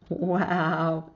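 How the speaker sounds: ordinary delivery, nothing marked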